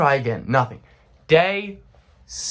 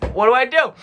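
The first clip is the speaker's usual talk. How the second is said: high-pitched, exasperated question; desperate plea; 8 semitones higher, 4 dB louder, faster than usual. Retold or imitated. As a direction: plead with the doctor, pitch up. Pleading with the doctor.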